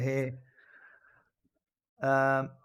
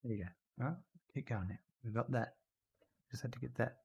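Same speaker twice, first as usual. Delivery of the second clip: soft pet talk; 6 dB quieter than usual. Real, not acted. Owner cooing softly to his old dog Randy, tender.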